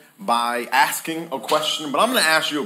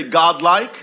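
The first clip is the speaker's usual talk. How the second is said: louder call-and-response; 4 semitones higher, 7 dB louder than usual. Shown as a demonstration. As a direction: shout it louder, rising. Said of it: "say it again".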